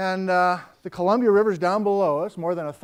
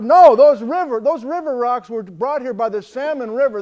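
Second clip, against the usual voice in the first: shouting over wind; 3 semitones higher, 7 dB louder than usual. Retold or imitated. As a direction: bellow it against the gale. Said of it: "we're shouting back and forth".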